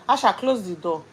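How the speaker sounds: loud call across the house. Real, not acted. Mother calling Asha to close the door, loud call.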